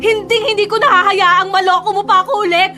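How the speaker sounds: angry. Acted. Rejecting cheating ex.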